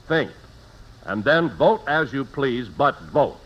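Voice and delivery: ordinary talk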